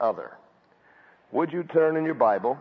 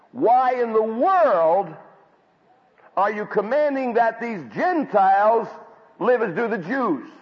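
slow, louder, higher demand; indignant challenge; 8 semitones higher, 5 dB louder than usual. Retold or imitated. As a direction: press the question hard, drawing it out. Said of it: "He confronted Peter and said".

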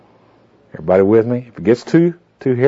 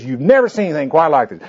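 raised-pitch salesman's rave; big show of enthusiasm; 5 semitones higher than usual. Retold or imitated. Man talking up his find to be overheard, put-on excitement. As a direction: rave about it, imitating a show-off. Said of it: "he put on a big show".

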